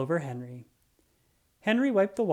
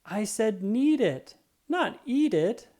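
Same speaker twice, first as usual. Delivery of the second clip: higher, slow frustrated shout; 7 semitones higher, 4 dB louder, slower than usual. A narrator's reading. Henry shouting at Mudge for eating the flower. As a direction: yell the correction at the dog. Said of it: "shouted Henry".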